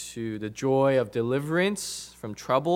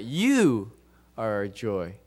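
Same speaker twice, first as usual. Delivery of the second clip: emphatic "you", lower on "are our joy"; stress on you; 3 semitones lower, slower than usual. Retold or imitated. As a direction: punch the word "you", let the rest fall. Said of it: "trying to emphasize the fact that".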